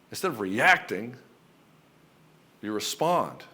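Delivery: normal talking voice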